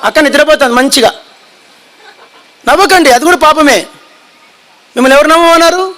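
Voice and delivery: usual speaking voice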